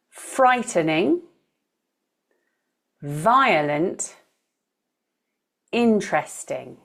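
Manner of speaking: FRIGHTening, VIolent, INteresting, listed with the first syllable stressed; frightening, violent, interesting as a slow list, stressed at the front. Shown as a demonstration. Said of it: "with the first syllable stressed".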